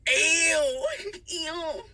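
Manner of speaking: loud disgusted ew then laughing. Real, not acted. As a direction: recoil in disgust. Disgusted reaction.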